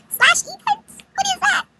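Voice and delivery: very high, baffled question; incredulous echo. Retold or imitated. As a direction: squeak it back in disbelief.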